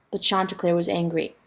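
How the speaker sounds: usual speaking voice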